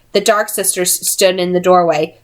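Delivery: ordinary delivery, nothing marked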